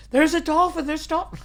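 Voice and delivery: imitating a girl's high, loud cry; excited young girl. Twelve-year-old girl first spotting the dolphin on the river, excited imitation. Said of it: "It was a twelve-year-old girl".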